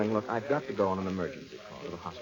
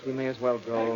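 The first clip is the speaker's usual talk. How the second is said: ordinary delivery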